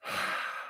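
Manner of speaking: long breathy sigh, imitated; weary phone-answer sigh. Imitating Jim Ross answering the phone with a sigh, breathy exhale. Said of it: "the first thing I heard from Jim Ross's mouth was this".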